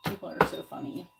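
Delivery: ordinary talk, nothing marked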